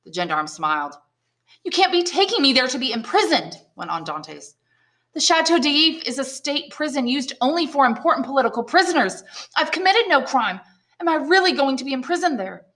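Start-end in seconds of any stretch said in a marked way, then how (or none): 1.6-3.6 higher, frightened questions; alarmed. Acted. Prisoner protests his fate. 5.1-12.6 higher, frightened questions; alarmed. Acted. Prisoner protests his fate.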